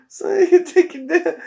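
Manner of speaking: laughing through the words. Real, not acted. Streamer cracks up at the Bill Burr joke and laughs the words out.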